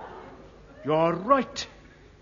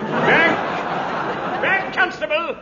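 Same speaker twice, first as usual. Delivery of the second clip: shouting; 9 semitones higher, 3 dB louder, much slower than usual. Acted. Inspector shoves constable away.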